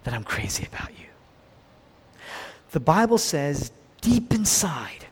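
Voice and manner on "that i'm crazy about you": hushed, intimate whisper into an ear. Acted. Woman's dream of Jesus whispering in her ear, whispered. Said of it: "Jesus leans over to me and whispers in my ear".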